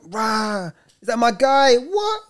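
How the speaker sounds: very high, excited hype-man voice; big it up, hyping the lad way up high; exaggerated high greeting to cheer a young lad; over-exaggerated hype. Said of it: "I would always, like, over-exaggerate".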